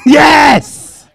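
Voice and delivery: loud, high-pitched cheer of yes; triumphant shout. Real, not acted. Celebrating during phone contest.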